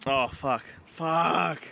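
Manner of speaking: ordinary talk, nothing marked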